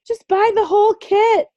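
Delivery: high, exasperated push to buy; mock pleading high voice; exasperated quote. To her friend, urging her to just buy the kit, high exasperated. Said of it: "and I'm just like".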